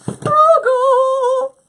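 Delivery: very high, sung-out holler, held long; Oprah voice. Acted. Host repeating it for the teasing crew, even bigger Oprah-style holler. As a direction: belt it out like a game-show reveal. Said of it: "There's the Oprah voice".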